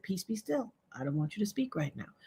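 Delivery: soft, low voice of God; hushed divine command. Retold or imitated. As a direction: voice God gently bidding silence. Voicing God telling her to be quiet.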